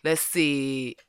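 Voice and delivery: ordinary delivery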